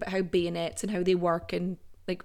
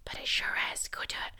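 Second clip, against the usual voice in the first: quick stage whisper, teasing; whispered aside; 8 dB quieter, faster than usual. Pretend. Host mock-argues with herself in a sneaky whisper. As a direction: slip the contradiction in under breath.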